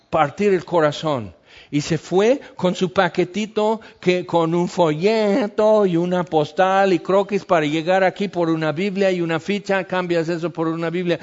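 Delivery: normal talking voice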